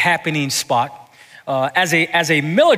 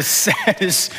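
ordinary delivery

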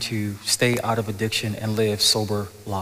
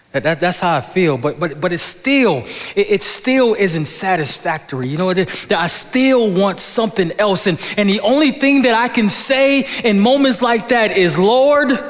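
rising louder and higher into a cry; impassioned build; 9 semitones higher, 6 dB louder than usual. Real, not acted. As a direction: build the plea until it bursts out.

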